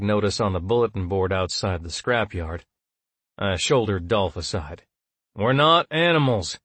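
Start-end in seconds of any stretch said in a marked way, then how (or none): 5.3-6.6 mild growl, raised pitch; a touch of indignant growl. A narrator's reading. Narrator answering the drone, mild growl. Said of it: "I growled".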